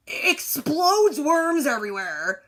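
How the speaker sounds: slow, breaking into laughter; laughing. Real, not acted. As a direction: laugh through it in disbelief. Laughing at absurd movie scene.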